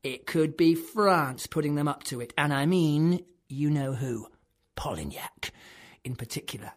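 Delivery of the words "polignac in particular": secretive whisper. Acted. Conspiratorial naming of the enemy.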